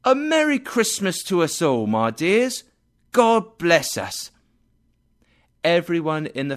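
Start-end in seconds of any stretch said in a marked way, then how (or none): 0.1-2.6 cheerful toast. Acted. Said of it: "He held up his own and said". 3.1-4.3 slow, louder, raised mug blessing; Bob's toast to his family; father's toast. Acted. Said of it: "He held up his own and said".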